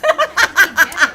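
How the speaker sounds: loud, high burst of laughter; real laughing burst. Real, not acted. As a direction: laugh out loud after a comeback. Laughing after her comeback.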